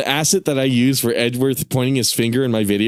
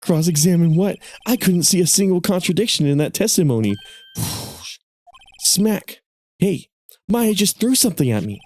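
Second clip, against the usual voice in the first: higher, lively anime-style figures; young lawyer and assistant voices; 5 semitones higher than usual. Acted. Lawyer and assistant plotting a bluff, higher voices. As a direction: play the rookie lawyer and his assistant.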